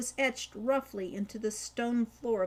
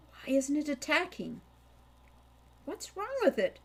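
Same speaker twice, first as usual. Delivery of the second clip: bewildered; 5 semitones higher than usual. A narrator's reading. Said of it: "in equal bewilderment".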